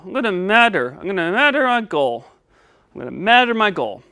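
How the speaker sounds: "measure" repeated as "madder"; lisping puppet. Pretend. Puppet repeating his step, "measure" said as "madder". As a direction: swap the zh in "measure" for a D. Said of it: "help you say your S".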